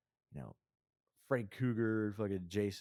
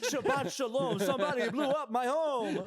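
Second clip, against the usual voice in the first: squeaky hammed-up voice; high silly put-on voice; high mock voice; 4 dB louder than usual. Mock sing-song voice.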